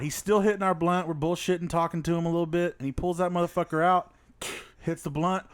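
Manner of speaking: ordinary talk, nothing marked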